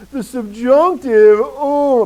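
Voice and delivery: loud, high, drawn-out mock groan; mock moaning. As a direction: moan it out in exaggerated dread. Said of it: "I remember just moaning".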